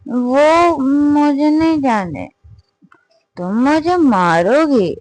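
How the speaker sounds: put-on drunk whine. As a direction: beg like a scared tipsy child. Drunk girl whining to partner.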